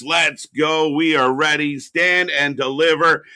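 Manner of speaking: loud hype announcer call. Acted. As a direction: call the show open, big and proud. Opens the show.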